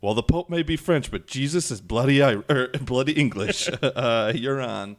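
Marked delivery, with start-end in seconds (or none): 0.0-3.7 higher, louder mock comeback; emphatic retort. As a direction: throw the retort back loudly, pitched up. Quoted comeback to French trash talk.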